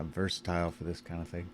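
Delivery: ordinary talk, nothing marked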